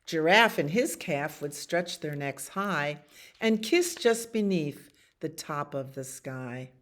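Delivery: ordinary delivery, nothing marked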